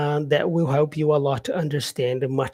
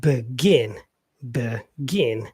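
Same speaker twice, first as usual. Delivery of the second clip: "begin" as be-GIN, lower, split into two beats; second-syllable stress; 4 semitones lower, much slower than usual. Shown as a demonstration. Teacher demonstrating stress to learners, "begin" stressed be-GIN. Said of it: "stress here is in the second syllable".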